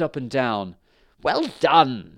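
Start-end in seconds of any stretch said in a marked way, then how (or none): 1.2-2.2 loud high gleeful cry; cried out gleefully. A narrator's reading. Dragon mother cheering her son. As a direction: crow with delight. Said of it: "she cried".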